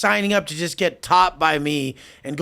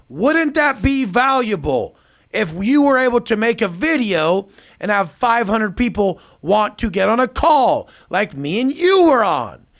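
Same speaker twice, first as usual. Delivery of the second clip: condescending pitch; 6 semitones higher, 4 dB louder than usual. Retold or imitated. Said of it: "I'm like".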